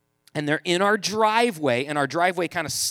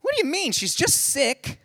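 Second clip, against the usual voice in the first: high-pitched mock disbelief; hammed-up clueless husband; incredulous protest; 9 semitones higher than usual. Husband protests wife staying home.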